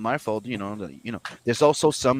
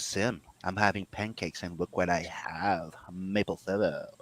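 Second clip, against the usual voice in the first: put-on Scottish accent; 5 semitones lower, 9 dB quieter than usual. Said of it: "Scotsman wakes up ... and goes".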